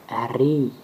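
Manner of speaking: R I with a rolled R. Shown as a demonstration. Teacher showing the rolled letter name, clear demo. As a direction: roll the R in R I. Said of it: "se roule".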